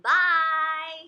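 loud, high, long-held "Bye"; calling goodbye. Pretend. Parent sees child off, loud drawn-out call.

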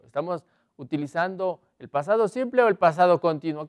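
usual speaking voice

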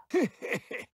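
short high gloating cackle; evil cackle. Acted. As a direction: cackle at a defeated foe. Villain laughs between lines.

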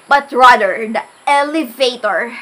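normal talking voice

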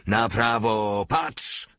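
drawn-out drill order, high finish; agitated sergeant's call, pitch leaping at end; screeched command. A narrator's reading. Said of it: "zaskrzeczał".

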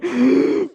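a sudden startled gasp; sharp gasp. Real, not acted. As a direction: gasp in fright. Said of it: "diye böyle bağırdım". (ani korkmuş nefes)

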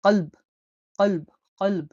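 কলব with a deep, throaty q at the start; deep qaf. Shown as a demonstration. Teacher models কলব word, deep throat q. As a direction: say কলব, catching the k far back. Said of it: "গভীরভাবে বলতে হবে".

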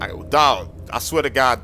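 ordinary talk, nothing marked

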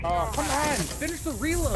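high, exasperated yell; frustrated shout. Real, not acted. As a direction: urge it to hurry, annoyed. Frustrated at game.

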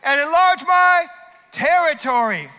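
bold prayer. Acted. Pastor shouting a prayer for blessing to God. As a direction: declare it to heaven. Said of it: "I'm saying this prayer".

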